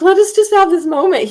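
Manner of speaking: high, retold plea; acting out mild exasperation at an interruption; upset protest. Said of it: "upset at her".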